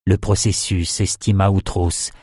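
ordinary delivery

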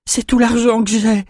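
put-on a young man whimpering for mercy; frightened whimper. A narrator's reading. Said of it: "gémit une voix de jeune homme".

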